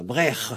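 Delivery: "Brech" ending in guttural kh; "Brech" as brekh, rasped; final C'H. Shown as a demonstration. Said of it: "comme ça".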